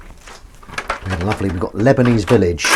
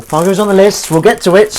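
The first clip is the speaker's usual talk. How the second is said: pitched way up, loud and bright; high, loud sing-song; 11 semitones higher, 9 dB louder than usual. Shown as a demonstration.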